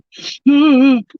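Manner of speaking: sudden loud gasp; sharp gasp. Real, not acted. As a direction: catch a breath in sharply. Talking about ugly crying ahead.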